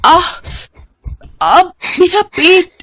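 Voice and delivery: high strained cry of stomach pain. Acted. Sick mother waking at midnight with stomach pain, high pained cry. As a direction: moan out in sudden sharp pain.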